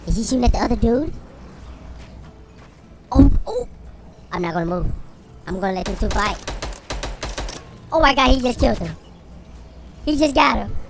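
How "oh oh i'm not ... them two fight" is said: ordinary talk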